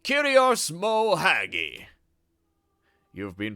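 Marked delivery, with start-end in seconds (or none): 0.0-1.9 slow, loud, giggly greeting from a flustered duke; a nobleman laughing nervously before a god. Acted. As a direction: fluster through the honour with a laugh.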